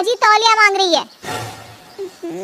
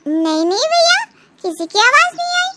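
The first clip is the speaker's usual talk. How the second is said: put-on nervous lying girl; 9 semitones higher than usual. Lily lies to brother.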